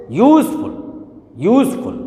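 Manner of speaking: Useful with YOOZ pushed high, FUL dropped; stress on first syllable. Shown as a demonstration. Teacher asking students to repeat, first syllable stressed. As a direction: lean hard on YOOZ, toss off FUL. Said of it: "इस पर stress आया".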